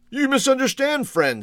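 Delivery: put-on high, lilting old man; kindly old merchant, pitched well up; genial old shopkeeper. A narrator's reading. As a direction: correct him gently in a high voice. Old man corrects the customer.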